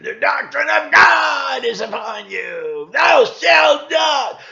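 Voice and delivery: high, loud, slow mock sermon shout; hellfire preacher. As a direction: thunder it like a pulpit preacher. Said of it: "churches that teach the doctrine".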